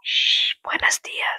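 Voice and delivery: whispered greeting after a shush; shush, then whisper it; hushed whisper. Pretend. Child greeting viewers beside parent, whispered.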